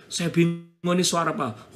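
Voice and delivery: usual speaking voice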